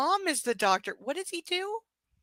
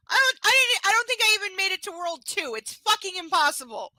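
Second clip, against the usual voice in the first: exasperated rant; 9 semitones higher, 5 dB louder than usual. Real, not acted. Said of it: "ranting about Overcooked".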